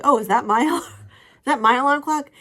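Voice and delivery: high, loud, laughing mock-confused husband; imitating the husband just woken, high and loud; husband's groggy surprise. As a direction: play him dazed and surprised. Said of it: "He's like".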